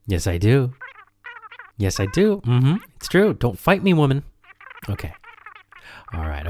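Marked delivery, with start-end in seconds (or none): 1.8-4.2 high, playful lover's insisting. Acted. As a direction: tease her back, pitched up. Playful lovers' banter on phone.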